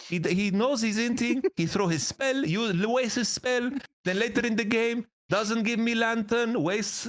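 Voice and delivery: high-pitched Greek-accented ranting, imitating Forgiven; Greek accent impression. Player mimicking Forgiven complaining about his support, high Greek accent. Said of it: "you're doing it".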